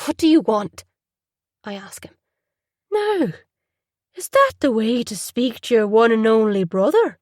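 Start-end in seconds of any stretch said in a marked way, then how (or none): 0.1-0.8 curt and cold. Acted. Hostile phone call with brother. 2.9-7.2 a touch of lilting Irish; Irish accent. Acted. Older brother teasing in a light Irish lilt. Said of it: "His accent is lyrical".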